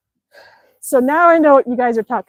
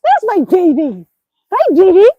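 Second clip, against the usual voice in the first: high, cooing pet voice; doting high-pitched pet talk; 6 semitones higher, 4 dB louder than usual. Real, not acted. Greeting the dog as baby.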